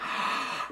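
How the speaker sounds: wide-open gasp for air; demo huge gasp. Shown as a demonstration. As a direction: breathe in with jaw down. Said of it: "Huge breath".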